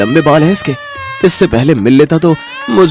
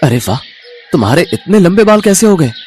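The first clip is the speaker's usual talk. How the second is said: amazed husband; 5 dB louder, faster than usual. Acted. Husband sees wife's long hair, amazed. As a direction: marvel aloud at her transformation.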